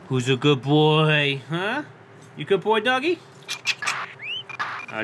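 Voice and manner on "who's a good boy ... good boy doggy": cooing at a dog. Real, not acted. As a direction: gush over the husky like a puppy lover. Cooing at a husky.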